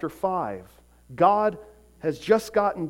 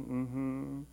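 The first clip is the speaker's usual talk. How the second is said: put-on soft closed-mouth yes from a guilty child; quietly fib to a parent; hummed yes, playing the guilty kid; 2 semitones lower, 10 dB quieter than usual. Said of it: "he has the guilty face".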